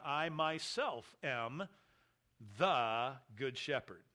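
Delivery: "myself" stretched and rising, then "the good shepherd" slow and falling; emphatic "I myself am" read slowly; stress on MYSELF. Shown as a demonstration. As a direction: lean hard on "myself", slowly. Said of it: "the emphatic pronoun".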